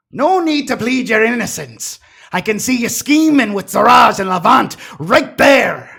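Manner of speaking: loud, high accusation; accusing shout. Acted. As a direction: call them out loudly. NPC accuses loudly.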